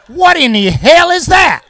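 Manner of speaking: mock disbelief at his own story; incredulous. Real, not acted. Incredulous rhetorical question.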